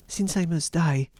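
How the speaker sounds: high-pitched plea of a condemned wife; pleading wife. A narrator's reading. Wife facing death at Bluebeard's word, high pleading.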